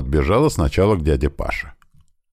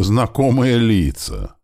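surprised; 7 semitones higher, slower than usual. Acted. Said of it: "удивлённо развёл я руки".

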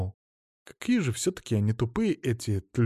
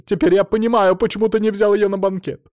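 high, loud, outraged scolding; screaming tirade; 7 semitones higher, 5 dB louder than usual. A narrator's reading. Mother-in-law berates her cheating son-in-law, outraged screaming. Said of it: "тут же завопила тёща".

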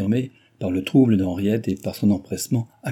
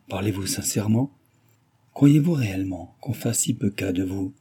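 usual speaking voice

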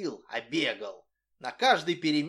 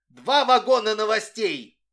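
loud, higher excited yell from the door; shout; 6 semitones higher, 10 dB louder than usual. A narrator's reading. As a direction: shout the news to the class. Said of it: "закричал".

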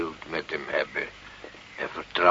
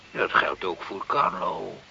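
usual speaking voice

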